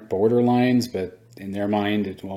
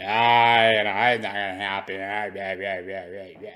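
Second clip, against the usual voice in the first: dismissive mockery. Mocking dismissive industry veterans.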